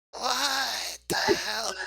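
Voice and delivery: high, slow, dazed cry of what the hell; a stunned goblin yelps in confusion. Acted. GM voicing stunned goblin, dazed high cry. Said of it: "looks dazed like".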